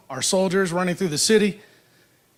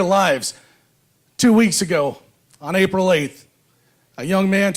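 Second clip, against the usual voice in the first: podium speech. Acted. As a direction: stir up the crowd from the podium. Rousing speech to rally crowd.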